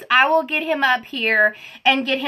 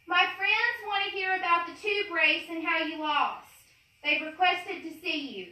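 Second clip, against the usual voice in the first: softer, higher, called to another room; calling offscreen; 5 semitones higher, 5 dB quieter than usual. Real, not acted. Asking husband off camera to come up, called. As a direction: call to him from across the house.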